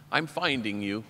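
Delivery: low, soft, reflective; quiet self-recognition. Retold or imitated. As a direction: say it gently to yourself in the mirror. Same words said softly to mirror.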